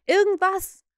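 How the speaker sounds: loud, high repeat; alarmed echo. A narrator's reading. Pirate echoes his captain's word, alarmed. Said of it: "hallte es von Mullins wieder".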